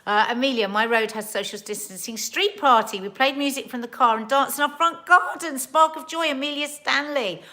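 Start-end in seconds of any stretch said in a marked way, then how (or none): 0.1-4.6 loud, bright burst of reading out a message; excited reading. Real, not acted. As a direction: read it out like big news.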